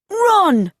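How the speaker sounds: high, urgent yell; urgent shout. A narrator's reading. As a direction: shout it as an order to flee. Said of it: "shouted D J".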